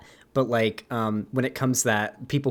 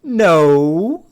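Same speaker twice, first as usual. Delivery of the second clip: drawn-out, louder New Zealand "No"; higher, mocking New Zealand accent; imitated New Zealand denial; 4 semitones higher, 7 dB louder than usual. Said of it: "And they'll be like".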